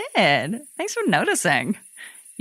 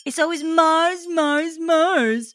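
jealous whine; 7 semitones higher than usual. Acted. Jealous Venus complains about Mars.

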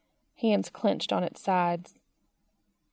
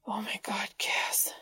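breathless whispered gasp; whispering; 7 dB quieter than usual. Acted. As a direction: whisper it, overwhelmed. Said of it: "Dean whispered".

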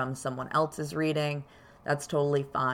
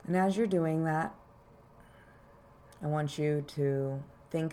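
calm grounding exercise; 4 dB quieter than usual. Acted. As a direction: guide them gently into their body. Leads a grounding meditation.

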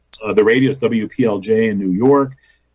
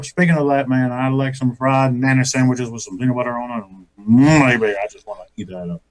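terrible [name]; 2 semitones lower than usual. Acted. Comic demonstrates his radio bit, terrible [name]. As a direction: do a bad [name] craving a snack. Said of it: "It was a terrible [name] thing".

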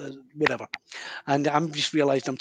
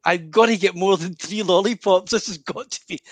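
exasperated inner voice; 6 dB louder than usual. Retold or imitated. Frustrated inner plea to spirit.

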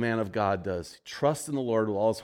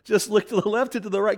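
same line again, pitched clearly higher; inner voice repeated higher; 8 semitones higher than usual. Retold or imitated. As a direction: let the voice insist, climbing up. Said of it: "The voice keeps saying".

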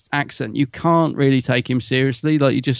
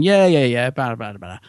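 bored dismissive mock; 2 semitones lower than usual. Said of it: "You just feel like going".

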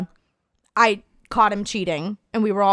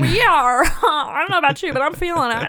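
smitten laughing; 5 semitones higher than usual. Retold or imitated. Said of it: "I'm like".

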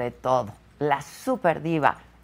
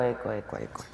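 much softer echo, fading away; canyon echo; 10 semitones lower, 8 dB quieter, much faster than usual. Pretend. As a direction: let it die off like a canyon echo. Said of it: "¿Se oye eco?".

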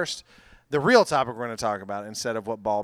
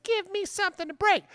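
put-on angsty kid voice. Mocking angsty kid quote.

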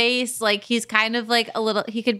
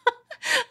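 laughing. Real, not acted. Laughs at own forgetfulness.

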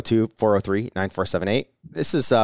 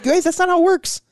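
exasperated high whine. Retold or imitated. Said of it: "like, like".

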